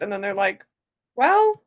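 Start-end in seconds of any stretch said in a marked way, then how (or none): 1.1-1.6 high mock shrug. Mocking the show's shrug.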